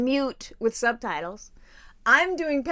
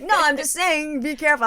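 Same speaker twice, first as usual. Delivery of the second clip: raised, emphatic mock caution; insistent warning; 5 semitones higher, 5 dB louder than usual. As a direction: imitate a friend protesting, higher and louder. Acting out friend's insistent warning.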